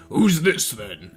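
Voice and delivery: put-on low, slow old wizard voice; drawn-out low character question; Gandalf. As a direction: ask it deep and unhurried, in character. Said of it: "And then Gandalf said".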